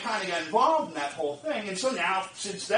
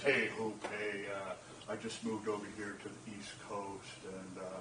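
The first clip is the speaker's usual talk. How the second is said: imitating Steve; 12 semitones lower, 14 dB quieter than usual. Friend's call, voiced.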